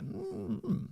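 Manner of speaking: Mm-hmm jumping very high in pitch; voice cracks high. Real, not acted. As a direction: voice slipping up high. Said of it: "I lose it and I go too high".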